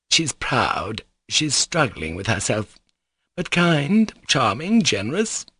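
plain reading voice

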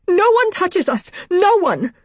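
frantic high-pitched shout; panicked, very high cry. A narrator's reading. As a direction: shriek a desperate warning to keep them back. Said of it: "My voice was high, frantic".

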